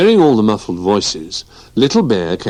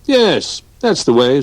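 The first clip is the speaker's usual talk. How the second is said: encouraging; 7 semitones higher, 4 dB louder than usual. Acted. George cheers Barnaby on.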